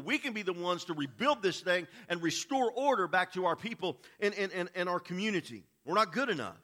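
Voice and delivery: loud, high-pitched impassioned preacher; preaching at full force. Acted. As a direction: cry it out to the congregation, drawing out key words.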